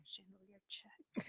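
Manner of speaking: breathy whispered echo. Real, not acted. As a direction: whisper it to yourself. Player echoes GM.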